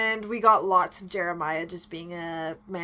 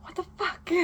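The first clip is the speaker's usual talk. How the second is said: whispered aside; 9 semitones higher, 7 dB quieter than usual. Real, not acted.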